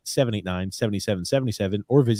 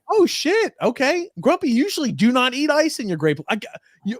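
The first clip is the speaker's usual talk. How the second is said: very high squeaky mock outrage; 14 semitones higher than usual.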